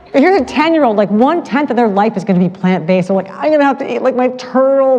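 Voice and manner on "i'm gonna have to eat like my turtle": put-on whiny kid. Sad kids voiced in a story.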